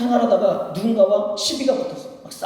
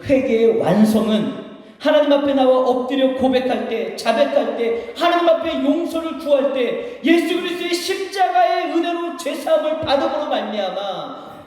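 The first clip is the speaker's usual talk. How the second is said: high, sustained preacher's cadence; forceful preaching; 7 semitones higher, slower than usual. Real, not acted. Pastor proclaiming forgiveness through the cross, forceful high preaching. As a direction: build to a high, ringing proclamation.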